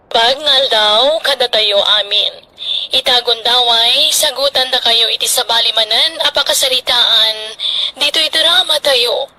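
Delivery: slow, measured announcer read; radio announcer. Acted. Host closing the drama and thanking listeners, formal announcer voice.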